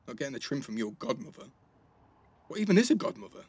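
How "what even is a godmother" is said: high and loud in disbelief; can't believe this is a thing; incredulous. Real, not acted. Baffled by the godmother idea.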